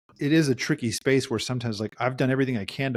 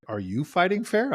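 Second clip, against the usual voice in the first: higher, rising questioning challenge; pointed challenge; 7 semitones higher than usual. Acted. Therapist questions a client who blames the partner, challenging. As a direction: turn the accusation back as a question.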